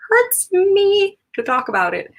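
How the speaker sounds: high, slow mock-wounded whine; mock pained. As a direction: whine it like the price still stings. Joking about the painful price.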